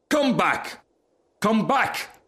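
high, urgent calling out; screamed. A narrator's reading. Rocket calling after the departing Duck, high acted scream. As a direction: call sharply after someone leaving. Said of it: "screamed the Rocket".

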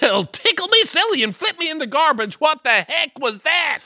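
high-pitched startled outburst; startled yelp. Acted. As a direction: jump out of your skin. Host startled by blown light.

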